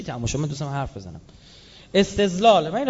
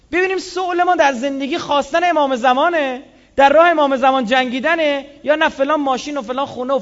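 fiery preaching; 6 semitones higher, 8 dB louder than usual. Acted. Preaching at full force.

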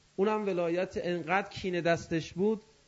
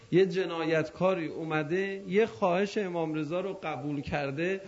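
usual speaking voice

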